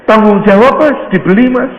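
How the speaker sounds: high, loud, emphatic demand; insistent urging. Acted. Villager demanding he buy the pastor's car, insistent. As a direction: push him hard to agree.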